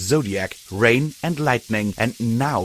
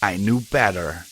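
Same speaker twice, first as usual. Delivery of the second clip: usual speaking voice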